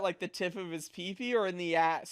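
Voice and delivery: normal talking voice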